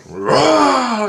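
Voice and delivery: loud, high-pitched "rah"; mock scare roar. Father opens door to scare daughter, loud roar. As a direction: let out a long playful roar to startle a child. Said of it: "I went".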